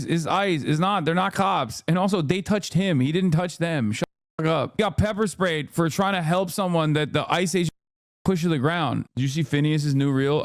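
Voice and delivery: normal talking voice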